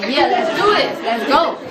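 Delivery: loud rallying cheer; rally the team with gusto; Disney-movie pep. Retold or imitated. Teacher pumping up students before competition, Disney-movie pep. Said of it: "like I was in a Disney movie".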